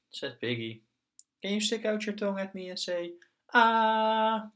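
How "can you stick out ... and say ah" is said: put-on high child voice. Piggy copies doctor for doll.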